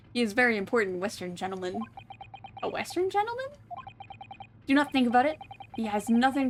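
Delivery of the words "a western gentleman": surprised companion. Acted.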